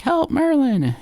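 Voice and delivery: very high, pleading call; mock cry for help. Storyteller plays boy calling his wizard, mock high cry. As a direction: call out high, comically helpless.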